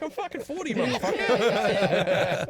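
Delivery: high, then loud and drawn out, laughing; laughing mock rant; mock indignant. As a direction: fake offended outburst. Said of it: "I'm like".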